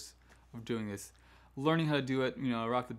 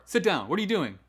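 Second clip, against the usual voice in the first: loud, higher, words run together; jumbled, rushed scolding; 5 semitones higher, 6 dB louder, faster than usual. Shown as a demonstration. Teacher scolding a pupil to sit, rushed and loud. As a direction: blurt the order and question in one breath. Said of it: "just a jumbled mess".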